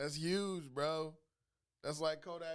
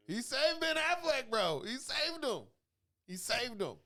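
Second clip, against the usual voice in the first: much higher and louder, slowed insistence; excited insistence; 11 semitones higher, 7 dB louder, slower than usual. Real, not acted. Telling a friend about Sean Penn, excited and loud. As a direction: insist it like it's gospel. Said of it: "I'm telling you".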